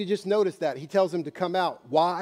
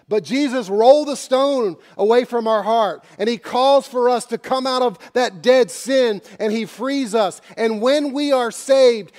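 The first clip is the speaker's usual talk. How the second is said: loud, rising sermon climax; fervent pulpit build; preaching at full force; 6 semitones higher, 7 dB louder than usual. Acted. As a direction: drive it home to the congregation.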